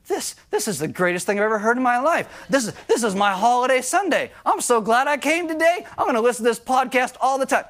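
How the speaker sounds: high, eager mock delight; gleeful angry person. Teasing angry listeners who think anger is approved, high and eager. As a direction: ham up the angry listener's joy. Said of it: "you're probably thinking".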